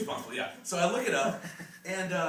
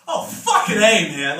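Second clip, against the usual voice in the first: slow, louder exasperated cry; loud outburst; 2 semitones higher, 10 dB louder, slower than usual. Retold or imitated. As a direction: blurt it out at the screen. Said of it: "and I go".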